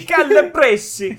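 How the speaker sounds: high, loud, drawn-out Italian parody; hammed-up Italian exclamation; mock Italian accent. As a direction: echo it in frantic cartoon Italian. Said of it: "Every time I do an Italian voice".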